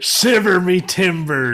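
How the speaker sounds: loud, slow pirate cry; mock pirate. Co-host joins the pirate joke, loud mock pirate. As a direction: bellow it like a hammy pirate.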